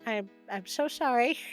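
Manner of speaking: ordinary delivery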